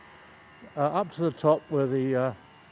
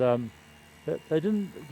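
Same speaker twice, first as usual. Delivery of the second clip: normal talking voice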